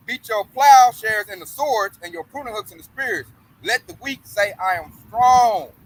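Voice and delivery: loud proclaiming scripture read; preaching at full force. Acted. Preacher reading Joel's war call aloud, loud. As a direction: call the nations to war from the pulpit.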